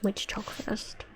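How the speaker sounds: whispered question. Real, not acted. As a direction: whisper it to yourself. Quietly asks which first.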